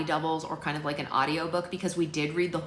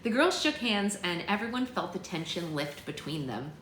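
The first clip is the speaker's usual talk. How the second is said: plain reading voice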